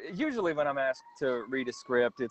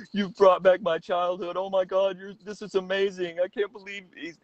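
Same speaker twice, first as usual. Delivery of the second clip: tearful gushing fan; 6 semitones higher than usual. Retold or imitated. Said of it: "is, is crying".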